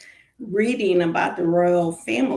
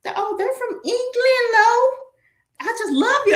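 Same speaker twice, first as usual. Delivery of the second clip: much higher, gushing mom voice; imitating mom; 14 semitones higher, 4 dB louder than usual. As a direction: play the doting mother, high and sweet. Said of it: "I'm like".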